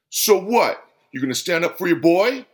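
aggressive, goading question; confrontational. A narrator's reading. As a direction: challenge him to a fight. Angry customer squares up to friend.

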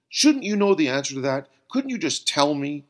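demanding. Acted. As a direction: press him for the answer. Bill demands an answer.

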